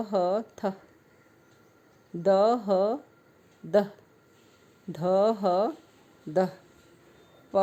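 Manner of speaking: slow letter drill, each sound held apart; phonics drill. Shown as a demonstration. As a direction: chant the sounds for children to repeat. Drills consonant plus ह.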